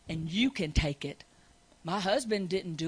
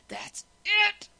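ordinary talk, nothing marked

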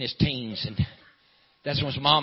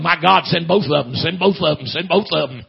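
raised, urgent mock holler, repeated like a plea; imitated hollering; 3 semitones higher, 7 dB louder than usual. Sister jumps up praying for both, imitated hollering. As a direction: holler it in part voice, as a retelling. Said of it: "jumped up and started hollering".

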